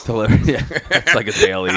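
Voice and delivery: words broken by real laughter; laughing. Real, not acted. Laughing at a friend's joke about work, real laughter. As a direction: talk through the laugh.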